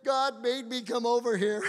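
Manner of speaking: high-pitched imitation of a tearful plea; retold crying. Neighbor's son insisting God sent him, mock crying. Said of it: "he starts crying. He goes".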